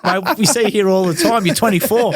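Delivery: high mock bouncer with a laugh; bouncer scoffing. Doorman calling out the underage regular, laughing mock voice. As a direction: scoff like the doorman who knows you.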